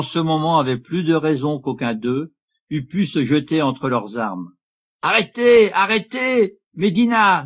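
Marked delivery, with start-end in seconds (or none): 5.0-7.4 loud, high-pitched call to halt; urgent cry. A narrator's reading. Cristoval throws himself between the swordsmen, urgent high cry. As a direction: break up a fight with a shout. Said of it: "s'écria-t-il".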